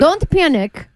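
put-on Russian accent. Newscaster telling viewers not to panic, mock Russian accent. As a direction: urge calm in a hammed-up Russian accent. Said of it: "you do the Russian accent".

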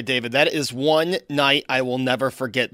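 normal talking voice

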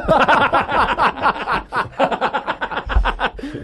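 a long, high real laugh. Real, not acted. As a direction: laugh freely at your own joke. Laughs at his own joke.

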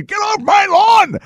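very high, drawn-out cranky holler; hammed-up yell at trespassers, slow and squealing; mock yelling. Host mocking the president as cranky, mock yelling. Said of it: "And he starts yelling".

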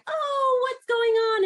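put-on high sing-song baby talk; parentese baby talk. Shown as a demonstration. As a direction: coo at a tiny baby. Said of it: "gets you going with this parentese".

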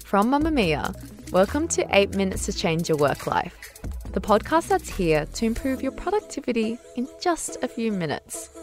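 bright podcast host intro; upbeat tidy advice vlogger. Acted. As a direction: welcome listeners like an upbeat host. Upbeat show intro read.

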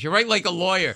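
loud, high teasing complaint; mocking. Comic as Leno ribbing the lawyer's writing, loud mocking. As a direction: needle him at high volume. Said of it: "he starts making fun of me".

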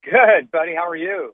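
distant speakerphone sound; talking over a speakerphone. Real, not acted. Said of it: "it sounds like you're on a speakerphone".

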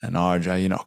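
ordinary delivery

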